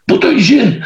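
high-pitched stubborn refusal; acted defiance, pitch jumping up; defiant recruit. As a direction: refuse the squad leader outright.

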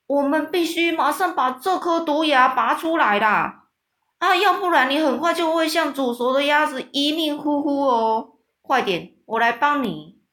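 friendly giant urging the coward to pull the fang now; urgent mock alarm. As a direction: rush him with fake urgency. Giant urgently faking rescue.

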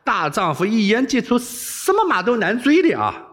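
high, emphatic promise; mock-solemn pledge. A narrator's reading. Wei Xiaobao promising to take the princess there, earnest. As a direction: vow it up high with great show. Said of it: "韦小宝正色道".

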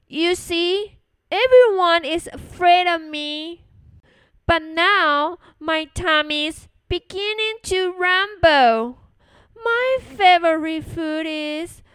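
loud, bold storybook bragging; boasting mouse. Acted. Mouse warns the Gruffalo its tummy rumbles, boastful. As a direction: declare it boldly to scare a monster.

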